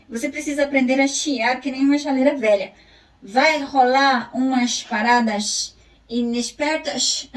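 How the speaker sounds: storyteller reading a funny Gaúcho chronicle; reading a text aloud. Acted. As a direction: perform the chronicle for the class. Continues the read text.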